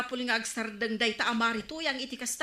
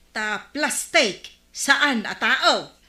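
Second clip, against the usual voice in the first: slow pointed retort; 8 dB louder, much slower than usual. Acted. Woman to gossiping neighbour, a slow, loud, pointed retort. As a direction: drag each word out, louder, driving the point home. Arguing that people are not plastic.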